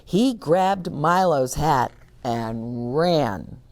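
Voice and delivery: plain reading voice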